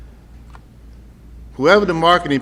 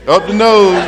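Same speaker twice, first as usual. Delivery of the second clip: louder, higher up the nose; ad slogan; 6 semitones higher, 6 dB louder than usual. Retold or imitated. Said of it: "the one with a vacation".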